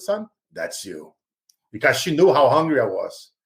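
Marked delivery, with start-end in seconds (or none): 0.0-1.1 tender grandma. Retold or imitated. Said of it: "she say".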